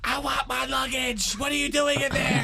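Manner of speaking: very high, drawn-out screech; imitating an outraged woman yelling; mock furious shriek. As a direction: shriek through a locked door, hammed up. Angry woman banging down the door.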